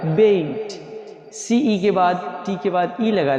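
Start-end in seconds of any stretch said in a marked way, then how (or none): 0.1-0.8 "बेट" with a gliding "ए-ई" vowel; long A vowel. Shown as a demonstration. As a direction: say "बेट" like English bate. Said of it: "ई हो जाएगा".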